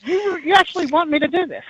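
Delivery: can't believe he's asking this; incredulous fear. Retold or imitated. Retold reply to Eddie's dad.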